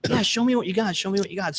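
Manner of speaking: high mocking voice of the guy; guy at the window. Salesman mimics the guy demanding to see the goods, high. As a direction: pitch it up and demand it.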